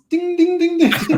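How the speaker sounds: high, ringing dings; ding like an alarm going off; imitated alarm dinging. Game master voices a lie detection spell going off, high dinging.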